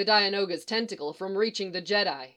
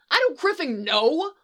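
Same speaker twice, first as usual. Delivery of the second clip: loud, high-pitched exasperated yell; yelling back; 8 semitones higher, 7 dB louder than usual. A narrator's reading. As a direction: yell it back, louder and higher. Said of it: "Han yells back".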